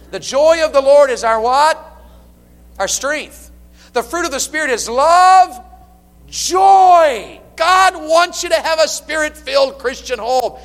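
loud, high, ringing sermon delivery; full-force preaching. Acted. Pastor exhorting married couples, loud and high. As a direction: preach it out to the whole church.

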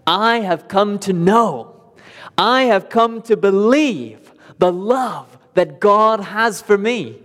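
slow heartfelt first-person declaration; earnest personal confession. Shown as a demonstration. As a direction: say it as if it were your own. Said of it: "can you say that".

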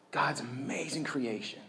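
whispered in awe; awed whisper. Real, not acted. Preacher recalling the view, whispering in awe. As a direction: breathe it out softly, amazed.

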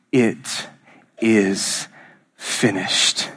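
low, word by word with pauses; final words of Jesus. Acted. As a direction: let each word fall solemnly and separately. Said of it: "hear the final words of Jesus".

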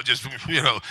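ordinary delivery

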